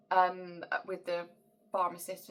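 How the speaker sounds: normal talking voice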